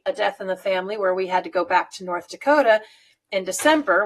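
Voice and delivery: ordinary delivery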